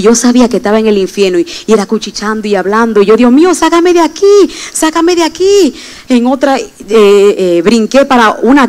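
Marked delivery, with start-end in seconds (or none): 3.1-5.7 much higher, pleading cry to God; pleading high voice, the last line drawn out; retold desperate plea. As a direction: relive the terror as she begs to be freed.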